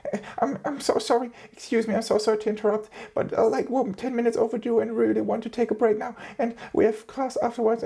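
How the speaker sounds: timid classmate in a high put-on voice interrupting the professor; high bitchy voice. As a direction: squeak it out like a timid student begging permission. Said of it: "high voice, bitchy ass voice".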